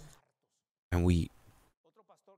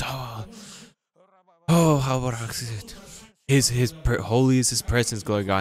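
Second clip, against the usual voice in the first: lightly hammed-up pious prayer voice; mock religious voice; 3 semitones higher than usual. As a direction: parody a churchy holy tone, a hint only. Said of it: "And I change my voice".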